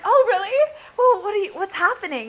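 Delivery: high, loud, eager questions; eager surprise. Retold or imitated. Retold phone call, eager reply.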